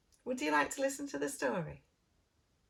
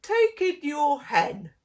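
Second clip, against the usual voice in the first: put-on slow, louder old man's advice; wise old man; 4 semitones higher, 10 dB louder, slower than usual. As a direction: offer it slowly like a sage. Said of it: "said the wise old man".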